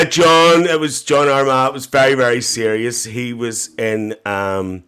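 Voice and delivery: normal talking voice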